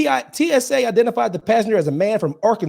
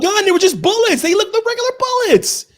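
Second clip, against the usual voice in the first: high-pitched, raised-voice protest; exasperated correction; 13 semitones higher, 3 dB louder than usual. Real, not acted. Host correcting his guessing co-host, exasperated and high. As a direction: insist it loudly, pitch shooting up.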